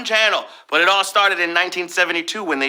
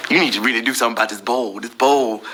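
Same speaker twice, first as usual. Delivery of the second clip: lightly voiced snake in the toilet bowl; 7 semitones lower, faster than usual. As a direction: talk fast like a snake in the toilet. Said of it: "That was my snake in a toilet impression".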